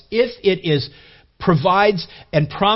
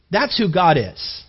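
ordinary talk, nothing marked

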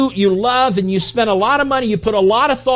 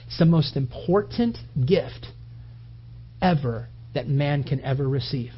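solemn preaching; 4 semitones lower, 5 dB quieter than usual. Acted.

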